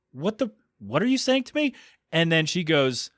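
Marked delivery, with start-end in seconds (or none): 0.1-1.7 high-pitched, baffled retort; mock confusion. Confused customer to store clerk, high and bewildered. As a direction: play a customer thrown by what she heard. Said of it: "she was confused".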